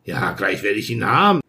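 low, calm, unhurried reply; a lightly voiced calm stranger. A narrator's reading. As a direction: answer coolly, unbothered. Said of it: "sagte er ruhig".

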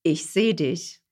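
soft and tender. Shown as a demonstration. Soft example phrase.

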